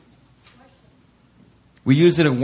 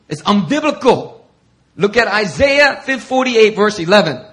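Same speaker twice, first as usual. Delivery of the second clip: much higher, louder rebuke; mock scolding critic; 8 semitones higher, 6 dB louder than usual. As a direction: wag a finger as the critic.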